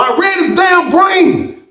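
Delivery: slow, high and emphatic; excited exclaim. Real, not acted. Narrator boasting to listeners, high and drawn out. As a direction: stretch it out, proud.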